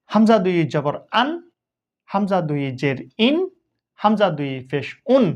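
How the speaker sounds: ordinary talk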